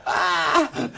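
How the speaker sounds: a very high shriek of shock; high-pitched mock scream of horror. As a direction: squeal like you just saw the cover. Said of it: "I'm like going".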